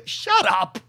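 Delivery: usual speaking voice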